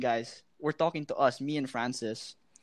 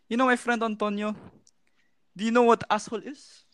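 higher-pitched imitated Middle Eastern accent, questioning; Arabic accent; 5 semitones higher, faster than usual. Shown as a demonstration. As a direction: quiz them in a mock Arabic accent. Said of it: "I'm just gonna do the accent".

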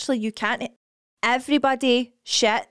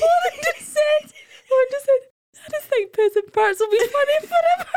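crying with laughter; 11 semitones higher than usual. Real, not acted. Friend repeating her line while crying with laughter. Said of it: "My cheekbones hurt".

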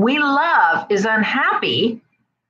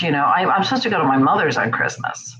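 usual speaking voice

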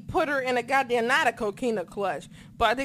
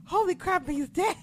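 higher, half-laughing disbelief; light high mock alarm; stunned disbelief; 7 semitones higher than usual. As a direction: gasp it like a shocked fan. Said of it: "I was just like".

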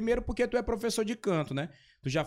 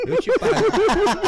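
laughing mock offer; 12 semitones higher, 7 dB louder, much slower than usual.

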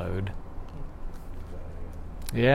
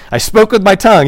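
loud confession; 7 semitones higher, 9 dB louder than usual. Retold or imitated.

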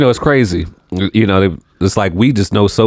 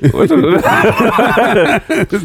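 laughing; much slower than usual. Real, not acted. Laughing at the name.

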